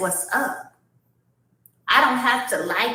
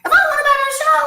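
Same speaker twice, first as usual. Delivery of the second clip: high, loud exasperated insistence; louder, higher, fed up; indignant outburst; 6 dB louder, much faster than usual. Real, not acted. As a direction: shout it like you've said it too many times. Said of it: "It's that simple".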